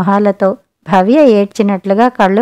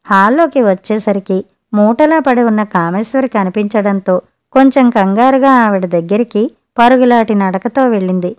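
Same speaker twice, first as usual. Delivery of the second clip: ordinary delivery, nothing marked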